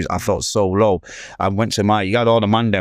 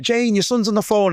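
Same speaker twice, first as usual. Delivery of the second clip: mimicked call to his wife, pitched much higher; 12 semitones higher than usual. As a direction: imitate a husband calling through the house. Husband calls his wife to the phone.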